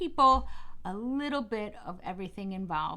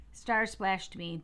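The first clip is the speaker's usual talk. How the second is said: plain reading voice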